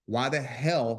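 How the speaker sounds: ordinary talk, nothing marked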